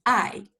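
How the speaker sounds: "I" said as a sharp, short falling tone; fourth tone. Shown as a demonstration. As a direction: drop "I" hard from high, short and a bit angry. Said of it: "the way that we pronounce this is".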